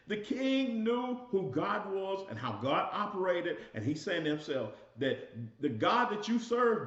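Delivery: building sermon fervor; preaching. Acted. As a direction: lift it and drive it home to the congregation. Rising into full preaching.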